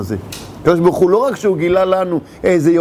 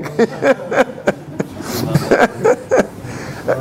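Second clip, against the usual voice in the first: long laugh; 5 semitones higher than usual. Real, not acted. Laughing at own joke.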